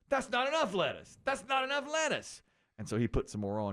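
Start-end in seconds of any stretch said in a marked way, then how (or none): 0.1-2.4 fussy customer. Retold or imitated. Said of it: "And she goes".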